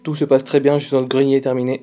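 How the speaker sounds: loud, into the walkie-talkie; loud radio call. Real, not acted. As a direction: shout it over the radio. Answering over the walkie-talkie.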